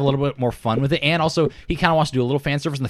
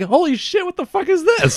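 shocked. Retold or imitated. Guards reacting to Bond, laughing.

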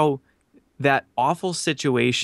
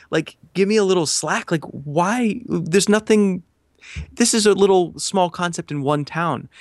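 higher, pleading protest throughout; exasperated plea; 5 semitones higher than usual. Retold or imitated. Pleading with the big company's lawyers, exasperated. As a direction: beg them for some slack.

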